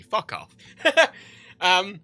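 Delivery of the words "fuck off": usual speaking voice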